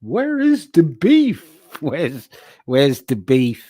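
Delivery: put-on high, slow, croaky old-lady ad voice; old ad catchphrase. Riffing on a chat joke as a hammed-up old-lady ad voice. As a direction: stretch it out like the classic commercial.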